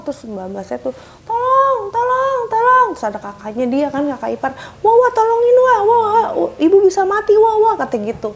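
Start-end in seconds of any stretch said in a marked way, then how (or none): 1.3-2.9 much higher, louder crying calls; desperate cry for help. Retold or imitated. Housemaid crying for help during the fight, panicked. 4.8-7.7 much higher, louder frantic calls; panicked cry. Retold or imitated. Sister-in-law calling for help, panicked.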